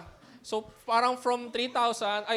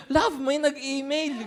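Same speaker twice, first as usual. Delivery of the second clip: mock husband; 4 semitones higher, slower than usual. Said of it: "tapos sabi mo bigla".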